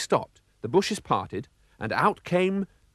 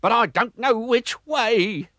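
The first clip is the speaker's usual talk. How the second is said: high, slow despairing pirate whine; wailing; 10 semitones higher, 5 dB louder, slower than usual. A narrator's reading. Pirate captain despairs over lost way, high slow wail. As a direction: sound lost and helpless.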